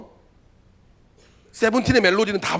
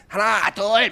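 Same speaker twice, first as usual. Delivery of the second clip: scary drill instructor; 5 semitones higher, 5 dB louder, much slower than usual. Retold or imitated. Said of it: "엄청 무섭게 막 날카롭게 이야기를 하거든요".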